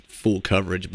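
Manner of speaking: usual speaking voice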